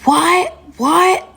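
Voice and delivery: high, sharply rising disbelief; retold angry screaming. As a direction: imitate his outraged yelp. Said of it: "he's just screaming and he's pissed off".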